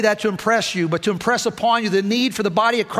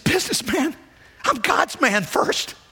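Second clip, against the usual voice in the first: high, strained with real emotion, slowing at the end; choked up; 7 semitones higher, slower than usual. Real, not acted. Preacher recalling his answer to the friend, choked up. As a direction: push the words out through a tight throat.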